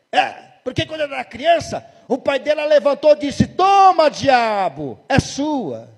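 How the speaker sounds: high, loud shouting demon voice. Retold or imitated. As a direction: bark out the story as the possessing spirit. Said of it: "E o demônio". (demônio gritando, agudo e alto)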